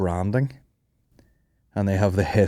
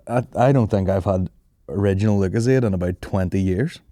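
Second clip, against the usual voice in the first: ordinary talk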